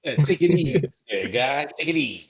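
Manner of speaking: calm the guy down like a wiseguy; mobster voice. Retold or imitated. Friend joining the Penguin joke with a hammed-up mobster voice.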